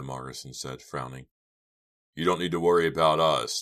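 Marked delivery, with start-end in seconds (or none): 2.1-3.6 louder, earnest assurance; forceful, eager reassurance; speaking up. A narrator's reading. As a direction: pipe up to convince her. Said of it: "Chuck spoke up".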